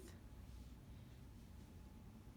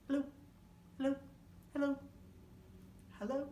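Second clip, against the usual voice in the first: fast hellos; 7 semitones higher, much faster than usual. Shown as a demonstration. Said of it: "Let's say hello as fast as we can".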